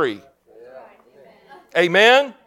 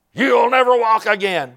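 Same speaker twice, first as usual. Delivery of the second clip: raised, higher voice of a taunting devil; devil's taunt; 6 semitones higher, 3 dB louder than usual. Retold or imitated. Devil taunting a bedridden man, raised-voice taunt. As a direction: taunt them in a high, forceful voice. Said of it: "the devil was like".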